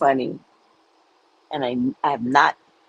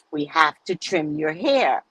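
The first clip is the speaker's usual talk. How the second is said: slow gentle. Retold or imitated. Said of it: "She would never say cut".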